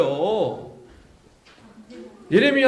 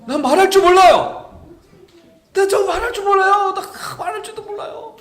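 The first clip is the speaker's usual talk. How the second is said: put-on much higher, pleading kid's voice; helpless whine; 12 semitones higher, 7 dB louder than usual. Jeremiah excusing himself to God, helpless whine. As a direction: protest like a child who can't speak up. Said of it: "예레미야가요".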